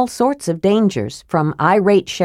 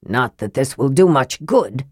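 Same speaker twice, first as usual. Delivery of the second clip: low, gruff grumble; low growl of complaint; 6 semitones lower than usual. A narrator's reading. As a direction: grumble it like a sceptical old dwarf. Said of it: "Flint growled".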